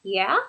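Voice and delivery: "Yeah" lifting sharply at the end; engaged, questioning upturn on "Yeah"; rising intonation. Shown as a demonstration. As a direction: say "Yeah" with the pitch going up at the end. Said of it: "You hear it go up at the end".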